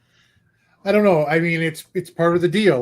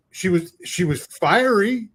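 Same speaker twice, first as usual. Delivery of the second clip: ordinary delivery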